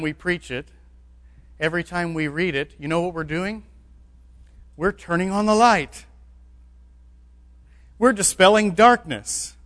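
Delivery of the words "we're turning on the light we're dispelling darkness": louder and higher with each phrase; rising preacher's cry. Real, not acted. Preacher to his congregation, rising to a ringing cry. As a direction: lift it to a ringing proclamation.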